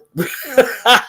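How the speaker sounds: real high burst of laughter. Real, not acted. Host cracking up at the notice joke, laughing. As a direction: burst out laughing mid-sentence.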